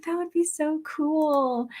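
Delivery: a hint of a starry-eyed kid; lightly voiced childhood wish; dreamy younger self. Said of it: "I was like".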